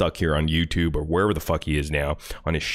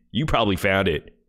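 ordinary delivery

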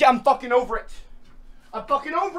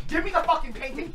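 usual speaking voice

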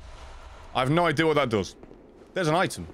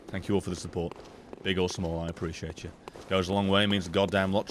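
soft thanks; 8 semitones lower, 5 dB quieter than usual. Real, not acted.

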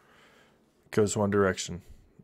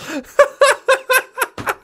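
shrieking giggle; high squeal laugh. Real, not acted.

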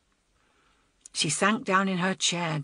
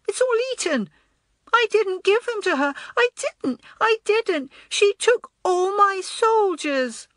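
put-on very high, louder, wailing small boy; piping little voice; 14 semitones higher, 4 dB louder than usual. A narrator's reading. Upset little boy accusing girl of taking his soldiers, piping. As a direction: protest in a small child's high-pitched wail. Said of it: "his little voice piping up".

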